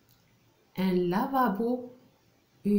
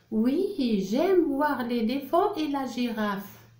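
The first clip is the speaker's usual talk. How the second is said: higher-pitched acted reply; Farida in read dialogue; 4 semitones higher than usual. Teacher voicing a reply about the park, high dialogue voice. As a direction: answer in a raised read-aloud voice. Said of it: "Je lis le dialogue".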